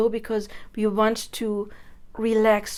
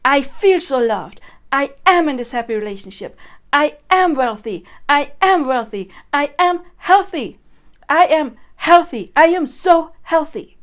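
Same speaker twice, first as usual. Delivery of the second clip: loud, higher, forceful hype; power incantation; 5 semitones higher, 9 dB louder than usual. Shown as a demonstration. Coach hyping herself with loud affirmations. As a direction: pump yourself up, power out. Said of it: "you can just put your power into it".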